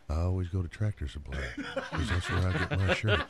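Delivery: very low cowboy mumble; hushed, deep country-cowboy pitchman. Retold or imitated. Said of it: "That's the way cowboys talk".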